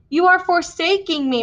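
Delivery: a touch of renewed tears; crying mother. Acted. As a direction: let the hurt break through. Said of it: "my mother cried afresh".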